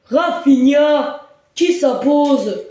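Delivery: high, slow, drawn-out goal call; excited sports commentary. Acted. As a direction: stretch the scorer's name out.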